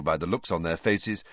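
ordinary delivery

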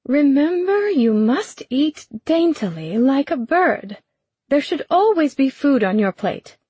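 high, prim and lecturing; prim governess voice. Acted. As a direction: play a fussy, proper stepmother teaching manners. Jessamine lecturing Corian on manners.

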